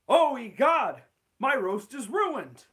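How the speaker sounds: loud, high, slow panic; panicked Skinner. Acted. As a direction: cry out that the roast is ruined. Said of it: "Steamed Ham script".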